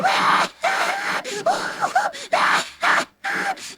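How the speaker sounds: squealing high brother giggle. Acted.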